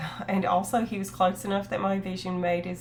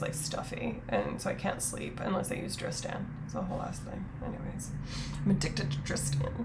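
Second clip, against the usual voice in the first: sniffly murmur; 8 dB quieter than usual. Real, not acted. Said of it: "I'm sniffly".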